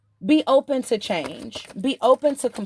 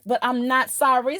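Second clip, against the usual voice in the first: ordinary delivery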